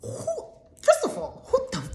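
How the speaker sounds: real incredulous laugh; laughing disbelief. Real, not acted. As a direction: squeak it out through laughter. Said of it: "who makes those sounds anymore".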